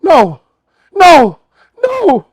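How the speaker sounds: high, loud wails, falling each time; panicked mock wail. Man imitating the woman on the bed losing it, high loud panicked wail. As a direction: imitate a woman starting to freak out, crying out high. She just starts going.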